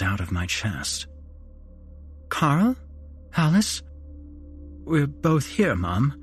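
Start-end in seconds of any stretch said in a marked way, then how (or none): 2.3-3.8 hopeful calling mother. A narrator's reading. 4.8-6.1 tearful. A narrator's reading. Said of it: "I answered tearfully".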